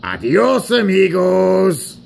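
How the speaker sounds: put-on high, loud Mexican-accented farewell. Rusty bids the engines farewell, Mexican accent. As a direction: call out goodbye in a Mexican accent. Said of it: "I'm from Mexico".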